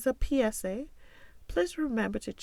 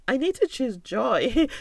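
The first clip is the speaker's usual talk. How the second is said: louder, rising resolve; excited realization; 4 semitones higher, 6 dB louder than usual. Retold or imitated. Voicing her own sudden decision to choose joy, rising. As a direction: land the decision with energy.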